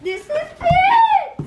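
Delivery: echoing squeal. Real, not acted. Kid echoes mother's squeal.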